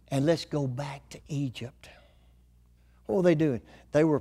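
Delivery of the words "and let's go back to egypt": hushed, breathless whisper of a plot; whispered rebel plot. Acted. Preacher voicing the grumbling Israelites, whispering to go back. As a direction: whisper it like a secret scheme.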